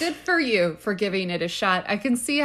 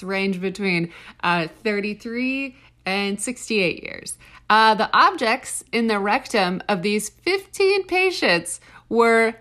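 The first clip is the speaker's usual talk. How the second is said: usual speaking voice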